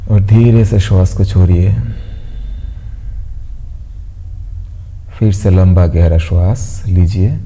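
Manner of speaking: calm, evenly paced meditation voice with long pauses; guided meditation. Acted. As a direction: guide them gently into stillness, pausing between instructions. (शांत, ठहराव भरी ध्यान कराने वाली आवाज़)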